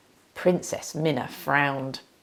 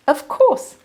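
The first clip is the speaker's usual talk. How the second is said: much higher, bright and obliging; cheerful milkmaid; 12 semitones higher, 5 dB louder than usual. Acted. The milkmaid agrees to lend her apron, high and cheerful. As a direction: trill it helpfully.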